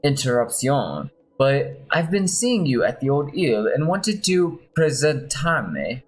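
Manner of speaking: a light put-on Spanish accent, slowing into the Spanish word; mild Spanish accent, savouring presentarme; unhurried light Spanish accent. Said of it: "sorry about my Spanish accent".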